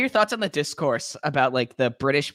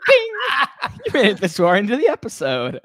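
mock game-show ding; 9 semitones higher, 6 dB louder than usual. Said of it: "and be like".

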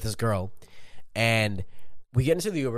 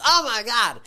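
high, loud shocked driver; foreign driver voice; 12 semitones higher, 5 dB louder, slower than usual. Retold or imitated. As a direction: squeal it like the panicked driver. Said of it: "some foreign dude".

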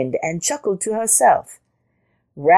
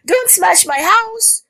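high, drawn-out mild scream; 4 dB louder, slower than usual. A narrator's reading. Rabbit blocking the charging elephant, high scream. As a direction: wail it high in alarm. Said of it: "she screamed".

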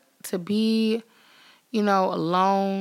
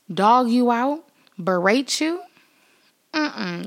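usual speaking voice

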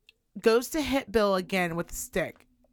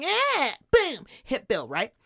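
mock battle cry; 3 semitones higher, slower than usual. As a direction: yell it as you charge. Kid charging with stick.

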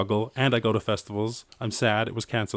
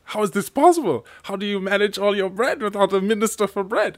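much higher, louder mock disbelief; baffled Russian minister; 12 semitones higher, 4 dB louder than usual. Russian minister baffled at no bread minister, mock disbelief. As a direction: play the bewildered official. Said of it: "And he's like".

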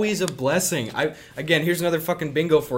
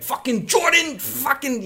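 much higher mock New York rant; angry New York yelling guy; 10 semitones higher than usual. Recalling the movie dad swearing at Jordan, mock angry New York. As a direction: rant like a furious New Yorker, half-hearted. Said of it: "New York yelling guy".

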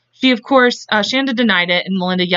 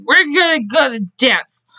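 higher, drawn-out mock-drunk announcement; drunken declaration; 5 semitones higher than usual. Host voicing drunk Melinda to co-host, mock-drunk declaration. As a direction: imitate a tipsy girl deciding to go. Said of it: "they got to drinking".

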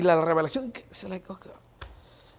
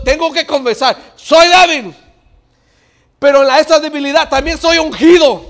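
building from raised voice to high, full-force shout; impassioned preaching; 8 semitones higher, 12 dB louder than usual. Real, not acted. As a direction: preach it louder and higher, to a roar. (predicación encendida, cada vez más fuerte)

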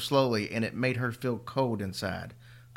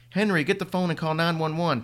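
loud, higher frantic shout; frantic yell; 6 semitones higher, 5 dB louder than usual. Acted. Mother yelling at her son to call for help, frantic. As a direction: yell the order in a panic. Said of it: "Tanya yelled frantically".

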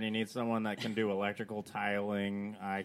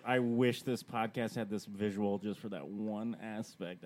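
normal talking voice

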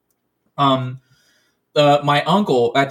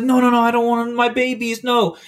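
retold scream; 7 semitones higher than usual. Aunt refusing to give up her dogs, mock scream. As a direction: imitate a panicked woman refusing, voice pitched up. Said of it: "she's screaming".

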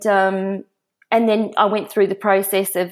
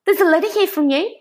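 high, quick retold phone panic; hysterical mum; 11 semitones higher, faster than usual. Mum phones daughter at work about a letter, hysterical. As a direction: imitate mum fretting, pitched up and hurried. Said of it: "my mum's in hysterics on the phone".

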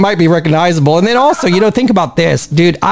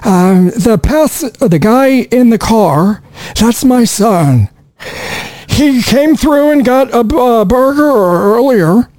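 put-on slow, higher old Mexican man; 3 semitones higher, slower than usual. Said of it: "Take five".

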